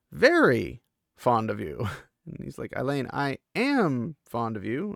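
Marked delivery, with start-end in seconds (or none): 0.1-1.9 VERY louder and drawn out; emphatic "very", then the rest follows; stress on VERY. Retold or imitated. Emphatic confession in retold scene. 2.8-4.9 "am" pitched up and drawn out; earnest retold insistence on "am"; stress on AM. Boy insists on his fondness.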